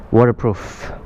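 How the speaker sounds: usual speaking voice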